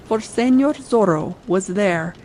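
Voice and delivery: ordinary delivery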